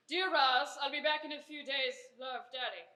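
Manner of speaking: quiet note reading. Acted.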